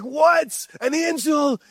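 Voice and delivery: high-pitched, startled disbelief; acted panic with a jump in pitch; freaking out. Preacher plays Zechariah startled by an angel, acted freak-out. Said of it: "freaking out".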